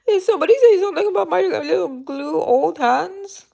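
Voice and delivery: high-pitched, put-on mock-indignant voice; playful put-on voice. Streamer answering chat about her hands, playful put-on voice. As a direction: pitch it way up in a silly voice.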